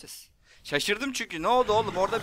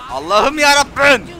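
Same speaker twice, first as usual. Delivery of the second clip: slow, louder, higher despairing plea; exasperated wail; 5 semitones higher, 6 dB louder than usual. Real, not acted. Gamer begging God after being hit, drawn-out wail.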